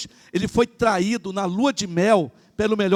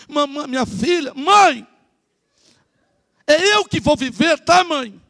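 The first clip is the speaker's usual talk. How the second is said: higher, louder insistent plea to her mother; pleading daughter; 6 semitones higher, 4 dB louder, slower than usual. Retold or imitated. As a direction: beg mom to let you live your life. (filha suplicando à mãe, mais alto e agudo)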